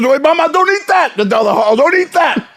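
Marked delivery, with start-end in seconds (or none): 0.0-1.2 high, urgent warning to his mama; alarmed warning. Retold or imitated. Friend warning his mama off the weed chocolate, high and alarmed. 1.8-2.5 high, urgent warning to his mama; alarmed warning. Retold or imitated. Friend warning his mama off the weed chocolate, high and alarmed.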